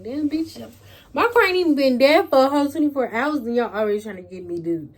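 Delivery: loud, high exasperated retort; exasperated protest. Retold or imitated. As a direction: act out pushing back, loudly fed up. Acted protest at pressuring relatives.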